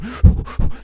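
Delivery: sharp loud high sigh; theatrical huff of breath; dramatic sigh. Retold or imitated. Compilation of apology sighs.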